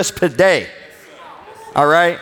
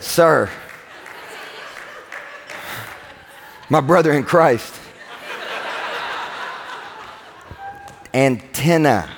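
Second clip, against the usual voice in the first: mock-patient "antenna" through laughter, lower; lightly voiced wife, half laughing; wife correcting him; 3 semitones lower, 6 dB quieter than usual. Wife correcting the pastor's pronunciation, mock-patient and laughing. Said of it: "She said".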